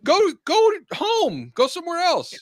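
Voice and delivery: very high, worked-up mock complaint; exasperated rant. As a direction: whine comically at everyone in your way. Acted rant while stuck in line.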